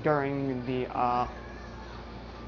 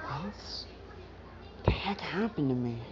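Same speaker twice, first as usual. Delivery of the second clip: whispered, much softer, bewildered; confused whisper; 8 dB quieter than usual. Retold or imitated. Midoriya waking up and asking what happened, dazed whisper. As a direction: breathe it out in shocked disbelief.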